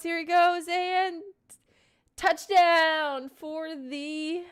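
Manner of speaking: slow, drawn-out, excited "touchdown" call; sports broadcaster. Pretend. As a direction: call the touchdown like a stadium announcer, stretching the big moments. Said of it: "A good sports broadcaster".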